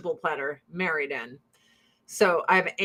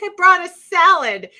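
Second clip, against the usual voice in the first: hurt aunt; 10 semitones higher, 9 dB louder than usual. Retold or imitated. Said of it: "She's like".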